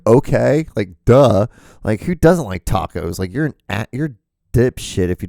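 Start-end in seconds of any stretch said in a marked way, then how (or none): 0.1-1.5 sarcastic duh. Retold or imitated. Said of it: "we're like".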